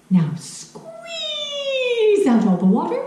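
high slow straining voice; strained squeeze. Acted. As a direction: stretch the word squeeze up high. Grandma demonstrates squeezing laundry.

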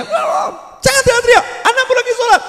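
very high, loud mock scolding; mother out-yelling child. Preacher acting a mother hushing her child during prayer, screeched loudly. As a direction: shriek a frantic telling-off, hammed up. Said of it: "suaramu ngungkuli anakmu".

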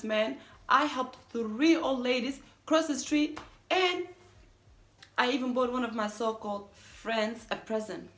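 loud, emphatic listing of offences; agitated confession. Acted. Confessing good deeds since being with you, agitated and emphatic. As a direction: reel it off in mounting disbelief.

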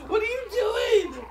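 alarm shout. Real, not acted. Yelling at partner.

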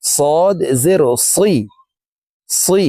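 slow "সদ জের সি" with a heavy emphatic s, unlike plain Sin; teacher's demonstration of emphatic Arabic Sad in "সি"; model Sad articulation. Shown as a demonstration. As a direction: draw out "সদ", keep the s of "সি" thick and heavy. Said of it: "সদের উচ্চারণ দেখুন এইভাবে পড়তে হবে".

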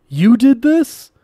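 very high, loud, slow shriek; terrified scientist screaming at the killer; accusing scream. A narrator's reading. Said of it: "Quan screamed".

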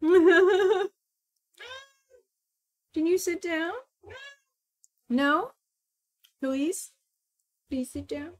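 sweet little plea to the cat; coaxing plea. Real, not acted. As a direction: coax a pet gently with rising questions. Pleading with cat to sit.

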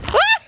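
very high sharp yelp; startled yelp. Real, not acted. Owner yelping when her puppy falls, very high. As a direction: yelp as the dog tumbles. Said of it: "Why do I keep yelling".

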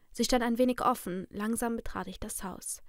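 ordinary delivery

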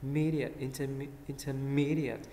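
"intermediate" built up slowly around MEE; stress on "mí". Shown as a demonstration. Teacher breaks the word down, "intermediate" stressed on MEE. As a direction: lean on "me" in "intermediate", short "at" ending. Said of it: "âm mí ha".

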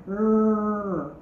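R said as a short growled rrr; letter sound R. Shown as a demonstration. Teacher isolating the sound R for kids, a short rrr. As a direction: give just the sound R, rrr. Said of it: "the letter that has the sound".

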